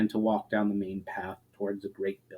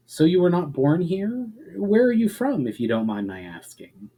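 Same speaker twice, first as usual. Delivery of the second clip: a lightly voiced eager young traveler, higher; curious questions pitched higher, lightly voiced; Valinar's voice; 7 semitones higher than usual. Valinar asks the elf questions on the walk, eager and higher.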